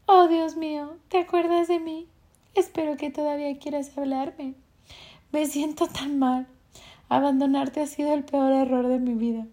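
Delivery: high, loud pleading voice, tearful; crying girlfriend. Acted. Ex-girlfriend phoning back, crying and loud. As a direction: beg to be forgiven, voice up high and loud. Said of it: "está llorando mientras te dice".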